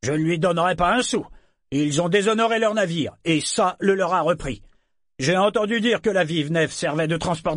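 high-pitched, contemptuous objection; indignant protest. A narrator's reading. Merchant refusing to pay for a disgraced ship, protesting. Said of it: "Freux protesta".

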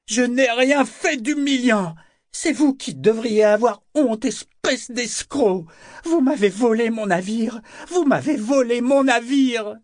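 very high, loud, drawn-out accusations; drunken outrage. A narrator's reading. Drunk Althea accuses Kyle of theft, shrieking in outrage. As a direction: shriek at him in tipsy fury. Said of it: "sa voix exprima trop clairement son abus de boisson".

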